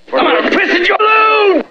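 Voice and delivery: very high shouted threat; angry outburst. Pretend. Caller threatening the pool shop, angry high shout. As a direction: shout it at the top of your pitch.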